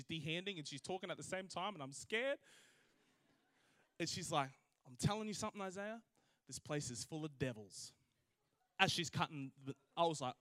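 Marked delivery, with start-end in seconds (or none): 4.9-7.9 low, hushed warning, sinking at the end; ominous confiding. Retold or imitated. Old woman warning Isaiah while cutting bananas, ominous confiding. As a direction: confide it quietly, dropping lower and softer.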